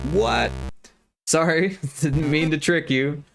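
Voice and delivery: ordinary talk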